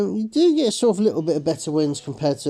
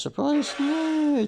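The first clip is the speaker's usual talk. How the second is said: sarcastic surprise; 14 semitones higher, much slower than usual. Acted. Mock surprise at a dud pack.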